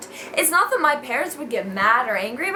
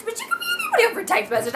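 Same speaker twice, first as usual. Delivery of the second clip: much higher, fast indignant rant; ranting squeak; much faster than usual. Retold or imitated. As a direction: rattle it off in outrage. Angry rant at boyfriend.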